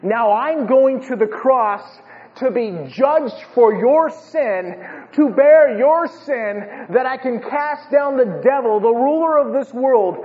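loud, high proclamation with stretched key words; impassioned preaching. Acted. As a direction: drive it home with full pulpit force. Preaches at full force as Jesus.

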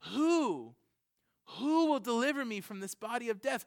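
anguished cry of a wretched man; Paul's lament. Retold or imitated. Paul crying out to be delivered from sin. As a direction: plead for rescue, voice straining up. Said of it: "And he's like".

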